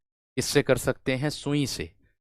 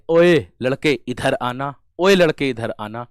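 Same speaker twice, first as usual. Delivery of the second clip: put-on loud call to a boy far away; teacher shouts ओए to a boy far off; 6 dB louder than usual. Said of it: "जैसे मैं कह दूं कोई व्यक्ति दूर".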